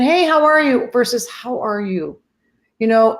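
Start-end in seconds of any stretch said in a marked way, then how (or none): 0.0-0.9 higher, louder breezy hello; casual generic greeting. Shown as a demonstration. Greeting someone as a generic introduction, casual and breezy. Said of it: "There's a difference between". 1.3-2.2 softer, sincere question; genuinely caring. Shown as a demonstration. Asking someone you really wanna know about, softly sincere. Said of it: "I really genuinely wanna know".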